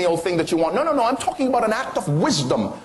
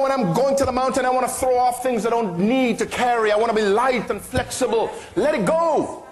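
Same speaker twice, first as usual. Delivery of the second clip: preaching at full force; 6 semitones higher than usual. Acted. Preaching rising in fervour.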